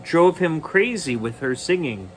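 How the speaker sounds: usual speaking voice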